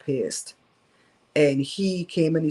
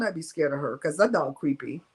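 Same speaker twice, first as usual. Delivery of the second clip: usual speaking voice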